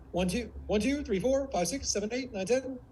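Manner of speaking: high, sharp rhythmic count; sharp warrior. Shown as a demonstration. Coach shows a different range, sharp warrior. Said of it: "sharp like this".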